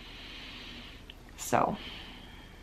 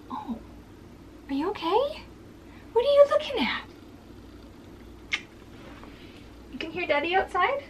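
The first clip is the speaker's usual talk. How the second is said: much higher, sing-song pet talk; 11 semitones higher, 6 dB quieter than usual. Real, not acted. Owner asking her dog what he hears, high baby talk.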